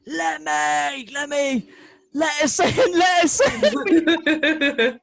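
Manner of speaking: high, drawn-out mock shouting; calling out. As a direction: re-enact pleading up at a window from the street. Calling up at [name]'s window.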